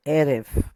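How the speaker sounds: "Erev" with a strong first syllable, E; stressed syllable. Shown as a demonstration. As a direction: hit the opening "E" of "Erev", let "rev" fall. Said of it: "Emphasis is right here".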